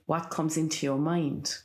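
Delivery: usual speaking voice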